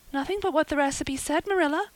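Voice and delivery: put-on high-pitched, anguished girl; anguished cry. A narrator's reading. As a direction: protest in high, rising anguish. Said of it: "cried Anne with a look of anguish".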